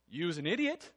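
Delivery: grandmother's Bajan accent, lightly imitated. Grandmother to grandson who did something stupid, imitated Bajan accent. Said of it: "she would say in her Bayesian accent".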